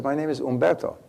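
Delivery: lightly imitated Italian waiter; Italian accent. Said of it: "he had an Italian accent".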